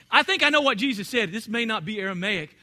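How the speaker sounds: ordinary talk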